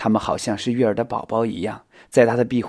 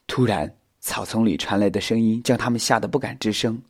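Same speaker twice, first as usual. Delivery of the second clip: usual speaking voice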